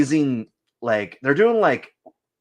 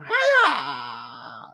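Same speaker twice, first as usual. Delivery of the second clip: mock stabbing cry with a laugh; karate yell; 2 semitones lower than usual. Voicing the stabbing figure with a laughing "Hi-ya". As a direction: yelp it like a clumsy fighter. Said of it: "He's going".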